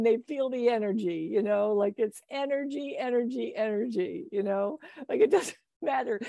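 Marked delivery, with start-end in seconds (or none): none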